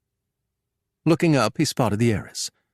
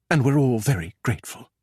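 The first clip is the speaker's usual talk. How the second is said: breathed; 2 semitones higher than usual. A narrator's reading. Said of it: "breathed the heiress".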